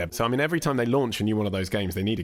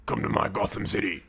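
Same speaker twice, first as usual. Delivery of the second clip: hard Batman voice. Retold or imitated. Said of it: "when he's being well hard".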